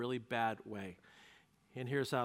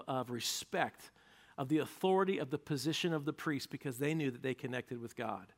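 ordinary talk

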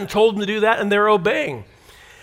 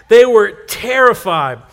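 They were terrified louder and slow; loud slow repeat; 6 dB louder, slower than usual. Shown as a demonstration. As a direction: call it out loudly. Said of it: "Thank you so much for the participation".

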